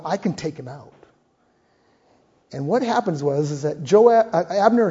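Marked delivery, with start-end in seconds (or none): 0.0-0.9 quiet resolve. Acted. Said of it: "I'm going".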